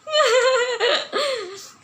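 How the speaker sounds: giggling high laugh. Real, not acted. Laughing at the loser.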